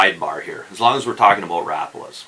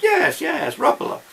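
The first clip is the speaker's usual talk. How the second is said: much higher mock Finnish reply, Rapala with a U sound; Finnish men's voices. Imitating three Finns saying Rapala, high and bright. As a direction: pitch it up like the Finns answering. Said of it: "And they're like".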